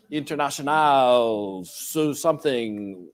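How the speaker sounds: slow, drawn-out go at French; attempted French accent. Shown as a demonstration. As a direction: fumble through it in a French accent. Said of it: "say it with a French accent".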